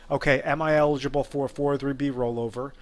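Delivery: normal talking voice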